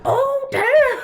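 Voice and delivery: high, loud shocked gasp of a line. Retold or imitated. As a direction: squeal it like you can't believe it. Reacting to a shocking comic moment.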